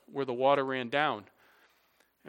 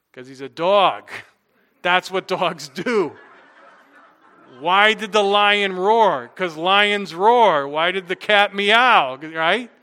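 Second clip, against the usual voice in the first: louder, slower, higher rhetorical questions; slow, loud pulpit emphasis; emphatic preaching; 4 semitones higher, 12 dB louder, slower than usual. Acted. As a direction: drive the point home to the congregation. Preacher's emphatic dog-lion illustration.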